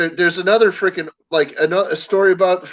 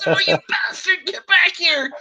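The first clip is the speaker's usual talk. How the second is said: high, pitched-up chase yell; mock angry yell; 12 semitones higher than usual. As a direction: call after the guy running off. Buddy chases the bean thrower.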